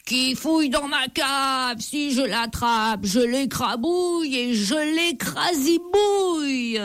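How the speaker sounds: a high, far-off booming ogre threat; terrible giant voice. A narrator's reading. The giant Colosse threatens whoever is in his cellar, in a terrible voice. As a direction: growl it out like a giant hunting intruders. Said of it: "La voix terrible de Colosse".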